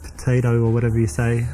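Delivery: ordinary talk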